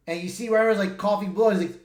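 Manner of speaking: ordinary talk, nothing marked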